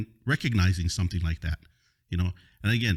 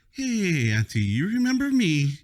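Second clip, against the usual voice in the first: high, falsely friendly greeting; mock-sweet greeting; 10 semitones higher, slower than usual. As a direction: sweetly rub it in. Confronting the driver at party.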